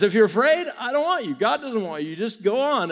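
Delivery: high, loud mock proclamation; bold announcement. Gideon announcing properly that the fearful may leave, proclaiming. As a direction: announce it out to all the men. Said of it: "But he announces".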